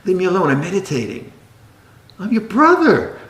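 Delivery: fussy offended monk voice, mock-righteous; mock precious monk. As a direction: parody a touchy monk guarding his meditation. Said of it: "monks get that way sometimes".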